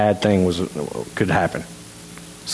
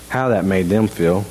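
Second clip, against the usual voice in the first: normal talking voice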